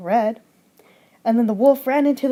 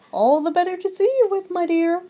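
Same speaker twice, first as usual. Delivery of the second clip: wolf as Grandma; 7 semitones higher than usual. Acted. Wolf disguised as Grandma answers.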